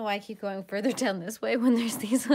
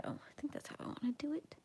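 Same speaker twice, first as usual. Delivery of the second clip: soft ASMR whisper; 4 semitones higher, 11 dB quieter than usual. Real, not acted. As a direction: whisper it close to the mic.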